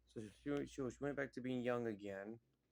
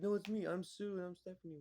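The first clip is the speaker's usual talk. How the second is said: pleading protest; 8 semitones higher than usual. Retold or imitated. Character pleading with crowd.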